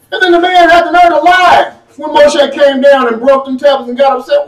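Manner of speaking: ordinary delivery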